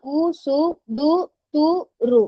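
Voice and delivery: each syllable spelled out with a pause; syllables one by one. Shown as a demonstration. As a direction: count them out slowly, one at a time. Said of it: "இங்க பாருங்க". (ஒவ்வொரு எழுத்தையும் இடைவெளி விட்டு சொல்லுதல்)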